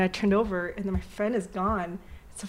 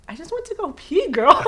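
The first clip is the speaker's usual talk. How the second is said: high-pitched mimicked friend's retort; exasperated friend; 9 semitones higher, 9 dB louder than usual. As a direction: imitate a friend's incredulous reply, pitched way up. Retells friend's exasperated reply.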